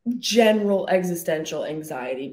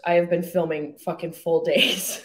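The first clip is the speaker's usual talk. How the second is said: ordinary delivery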